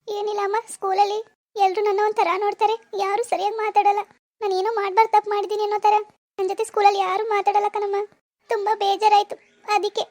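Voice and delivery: daughter complaining through tears; tearful, upset. Acted. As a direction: confide your hurt to mother, weepy. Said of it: "ಯಾಕ್ ಅಳ್ತಾ ಇದ್ಯಾ?".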